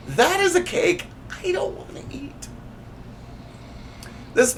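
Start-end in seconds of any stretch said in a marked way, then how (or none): 0.1-2.5 squeaky disgusted aside. Real, not acted. Reacting to gross cake text.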